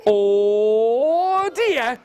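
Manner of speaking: long "Oh" swooping up; dawning dismay. Acted. Wife realising her mistake, drawn-out rising dismay.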